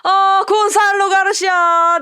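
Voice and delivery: high, loud, drawn-out goal roar; 극적인 골 환호. Real, not acted. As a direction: stretch every word in a jubilant goal call. Late dramatic goal called live. (길게 내지르는 골 중계)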